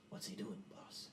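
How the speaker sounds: low, quiet aside; hushed henchman. Acted. Henchman leaning down beside the boss, hushed. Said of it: "leans down next to you".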